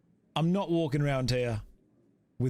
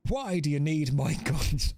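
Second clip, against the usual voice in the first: put-on improvised Irish accent breaking into a laugh. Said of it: "he's Irish".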